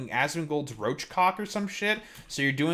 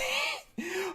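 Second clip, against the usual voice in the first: sudden high burst of laughter; high laugh at a chat commenter; real laugh; 8 dB quieter than usual. Real, not acted. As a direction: cracks up high and breathy.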